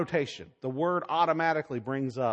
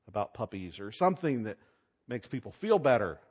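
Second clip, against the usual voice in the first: imagined editor; 6 semitones higher than usual. Pretend. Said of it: "I would have said".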